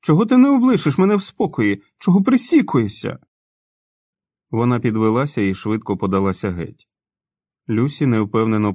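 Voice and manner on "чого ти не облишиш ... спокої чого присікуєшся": high, loud shrieking complaint; hysterical outburst. A narrator's reading. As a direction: she lashes out to be left alone. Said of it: "мало не істерично гримнула вона". (істеричний вигук)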